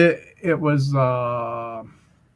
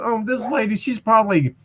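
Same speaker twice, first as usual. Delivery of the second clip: put-on old lady voice; 9 semitones higher than usual. Teller mimics an old lady.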